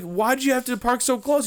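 high-pitched retold complaint; exasperated confrontation. Asking driver why he parked close, exasperated. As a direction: throw the question high, in exasperation.

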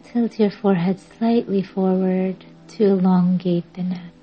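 usual speaking voice

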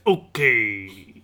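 low, deep gorilla grunt. Acted. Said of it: "He says".

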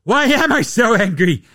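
a hint of an angry commissioner; mock Adam Silver. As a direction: ham up an exasperated league boss. Adam Silver imagined dialogue.

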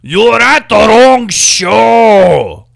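loud, slow and menacing sneer; sneering man. Retold or imitated. Angry stranger leaning into a tourist, slow loud sneer. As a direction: lean in and spit it at her, slow. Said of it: "he leans into her and he goes".

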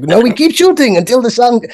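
high, loud German director voice; German accent. Retold or imitated. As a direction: imitate a crazy German director. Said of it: "in his crazy German accent".

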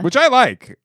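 high excited burst. Real, not acted. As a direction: blurt it out, high. Excited agreement.

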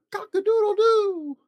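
very high, loud crowing cock-a-doodle-doo; rooster crow. Acted. Dad reading Seuss to a child, loud rooster crow. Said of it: "He can go like a rooster".

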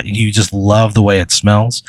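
usual speaking voice

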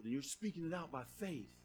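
usual speaking voice

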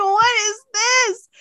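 very high, drawn-out disbelief; incredulous squeal. Retold or imitated. Viewer reacting to the silly vampire, high incredulous squeal. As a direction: squeal each word, stretched out. Retelling own disbelief at scene.

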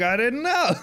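high mock-innocent whine. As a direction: play dumb in a high whine. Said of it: "He's like".

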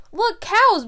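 high, loud "Look, cows!"; excited great aunt. Retold or imitated. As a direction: point out the window like an eager aunt. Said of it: "your great aunt Patty points out the window".

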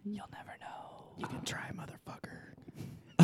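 slow, breath-only whisper; teasing whisper. Real, not acted. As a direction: whisper it slowly into the listener's ear. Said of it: "there's that whispering thing".

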